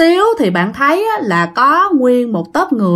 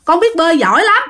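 high-pitched, indignant boasting; loud protest; 6 semitones higher than usual. Acted. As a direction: protest in a raised, higher voice. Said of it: "nó mới lớn tiếng nói". (lớn tiếng cãi lại, giọng cao)

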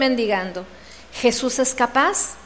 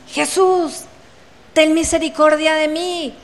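high-pitched retold plea; imitated crying out; 4 semitones higher than usual. Blind beggar crying out to Jesus, imitated shout. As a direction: call out for mercy in a raised imitating voice. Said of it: "cuando él empezó a gritar".

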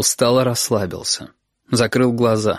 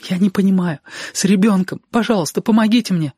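высоко, громче, почти плача; умоляй в полном отчаянии; паническая мольба; 9 semitones higher than usual. Acted. Сашка в панике просит помощи. (отчаянная мольба о помощи)

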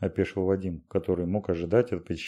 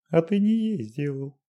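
lightly gentle sing-song voice; 9 semitones higher than usual. A narrator's reading. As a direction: coo it gently. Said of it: "ласково пропела Дашенька".

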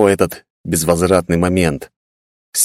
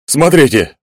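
sudden call; 8 semitones higher, 4 dB louder, slower than usual. A narrator's reading. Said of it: "внезапно сказал Гунрам".

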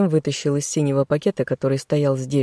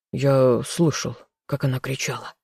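gloomy grumble. A narrator's reading. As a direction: mutter it darkly. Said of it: "мрачно буркнул Николай".

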